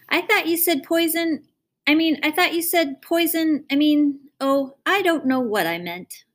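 put-on a small girl anxiously asking her father; little girl voice.

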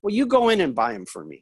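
lightly voiced mom giving an errand; directing mother. Mother sends her son into the store, directing. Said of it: "And my mom goes".

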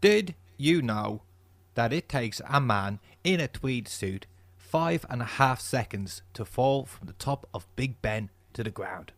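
amateur Cockney film-star voice, measured fact delivery; Michael Caine. Acted. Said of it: "so I'm gonna try to do that".

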